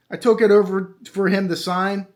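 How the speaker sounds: ordinary delivery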